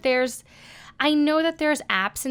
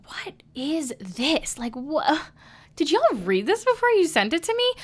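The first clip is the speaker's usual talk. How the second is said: baffled, pitch jumping up on the question; incredulous reaction; 6 semitones higher than usual. Retold or imitated. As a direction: play up the "are you serious?" disbelief. Mock reaction to bad auditions.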